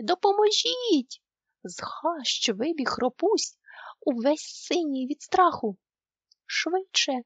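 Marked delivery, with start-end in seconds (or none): 0.0-1.2 high, loud, slow call for help; cry for help. A narrator's reading. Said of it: "І тут вони почули". 6.4-7.1 mild shout. A narrator's reading. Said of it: "закричав він".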